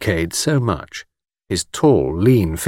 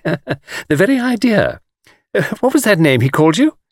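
put-on coquettish young woman; 9 semitones higher than usual. A narrator's reading. Miss Taylor quizzes the guide, coquettishly. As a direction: tease him in a high voice.

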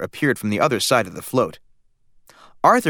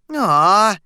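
put-on disappointed little boy; 9 semitones higher, 5 dB louder than usual. A narrator's reading. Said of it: "said Arthur".

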